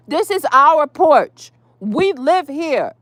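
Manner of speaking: slow, high, emphatic claim; indignant insistence. Real, not acted. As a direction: lay it down word by word, affronted. Staking claim to porch, worked up.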